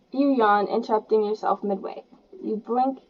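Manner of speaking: ordinary delivery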